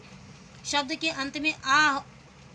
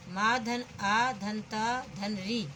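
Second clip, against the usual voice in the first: slow spelling out; much slower than usual. Shown as a demonstration. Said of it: "मातृ".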